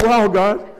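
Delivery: amazed. Retold or imitated. Amazed at the neighbor's words.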